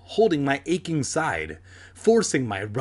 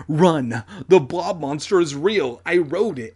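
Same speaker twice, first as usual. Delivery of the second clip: raised, louder voice of alarm; higher, insistent outcry; urgent warning; 4 semitones higher, 6 dB louder than usual. Acted. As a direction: shout it as a frantic warning. Urgent warning to friends in story.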